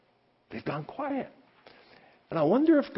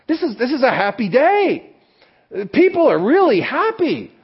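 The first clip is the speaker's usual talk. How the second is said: excited preaching; 9 semitones higher, 6 dB louder than usual. Acted. Describing joyful Palm Sunday.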